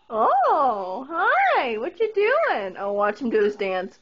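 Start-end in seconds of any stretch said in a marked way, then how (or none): 0.1-2.8 put-on high sing-song baby voice to a parrot; pet baby talk. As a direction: coax the bird like talking to a toddler. Greeting the bird.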